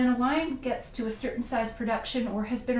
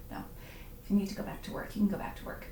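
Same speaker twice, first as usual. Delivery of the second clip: soft reassurance; 2 semitones lower, 7 dB quieter, much faster than usual. Real, not acted. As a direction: drop the teasing and quietly let them off. Takes back the teasing, gives permission.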